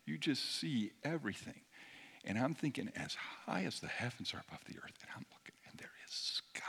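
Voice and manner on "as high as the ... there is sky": whispered, reverent sermon lines; hushed awe. Acted. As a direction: breathe it out in wonder. Intimate whispered preaching.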